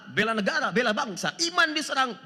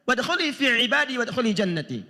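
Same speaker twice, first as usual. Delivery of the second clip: recited Arabic verse, a little louder; Quran recitation; 4 semitones higher, 4 dB louder than usual. Acted. Preacher reciting the verse to the congregation, chanted. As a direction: intone it like tilawah.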